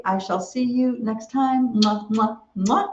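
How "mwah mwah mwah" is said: between kisses. Real, not acted. Signing off blowing kisses.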